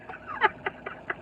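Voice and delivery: a breathless, very high giggle; squeaky high laugh. Real, not acted. Vlogger cracking up at his own troll, squealing laugh. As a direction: let a squeal of laughter escape.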